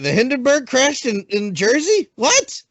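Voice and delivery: high squeak of fake disbelief on What; mock shock. Riffing on the tuned-in friend, mock surprise. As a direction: play dumb at ancient news. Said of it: "joining the mock-news bit".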